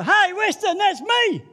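loud high call across a hall. Retold or imitated. Preacher retelling calling out to Weston in a meeting, loud. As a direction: shout it out eagerly, high and loud.

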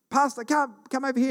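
high, urgent call across a field. Retold or imitated. Frightened kids shouting for their youth pastor, calling out high.

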